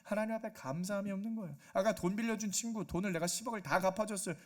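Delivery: ordinary delivery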